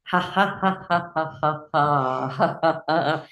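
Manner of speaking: deliberate, evenly spaced laughs; forced rhythmic ha-ha-ha; exercise laughter. Shown as a demonstration. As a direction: laugh out in steady beats. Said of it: "So do it now".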